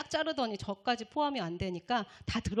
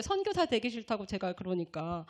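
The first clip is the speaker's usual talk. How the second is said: usual speaking voice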